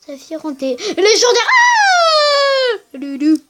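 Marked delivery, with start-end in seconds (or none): 1.5-2.8 high laughing shriek of delight; excited shriek. Real, not acted. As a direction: shriek with joy at the find. Réaction à un objet légendaire. (cri aigu de joie en riant)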